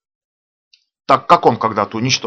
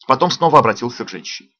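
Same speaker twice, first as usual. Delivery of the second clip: plain reading voice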